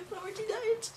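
high laughing mock wail; hammed-up fan sobbing through giggles; mock crying. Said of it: "and I was like".

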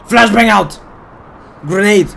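loud, urgent tactical callout; shouting callout. Real, not acted. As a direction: yell it to warn the squad. Throwing grenades in shooter game.

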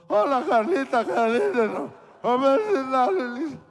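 put-on loud, high breaking teenage voice; voice cracking. Teen Geri greeting Carlita, voice cracking loudly. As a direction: let the voice crack like a boy in puberty. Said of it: "nos traicionan las cuerdas vocales".

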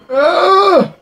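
high, loud pained scream. Real, not acted. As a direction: scream as you get hit. Hit in game.

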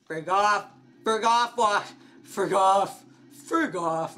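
loud frantic yelling; angry yell. Real, not acted. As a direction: yell at him to back off. Yelling at monster.

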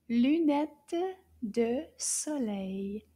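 "Lunette de soleil" very slowly, word by word; "Lunette de soleil" drawn out with clear gaps; slow model pronunciation. Shown as a demonstration. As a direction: stretch out "Lunette de soleil" for learners. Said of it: "this one begins with the L sound".

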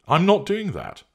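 high, loud, emphatic refusal; indignant refusal. Acted. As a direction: refuse outright with voice jumping up. Refusal in acted phone quarrel.

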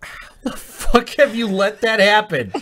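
high, slow, laughing outrage; disgusted disbelief. Real, not acted. Player scolding a friend over the sticky glass, disgusted. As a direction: protest through laughter, pitch up high.

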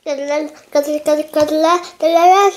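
excited stammer. Real, not acted.

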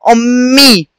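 "أمي" with a long hummed m and clear final ee; doubled m demonstration. Shown as a demonstration. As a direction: hold the double m in "أمي" for the learners. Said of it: "عشان بيتنطق مرتين".

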